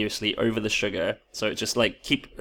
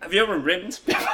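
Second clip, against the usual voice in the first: laughing; 8 semitones higher than usual. Real, not acted. Laughing at innuendo.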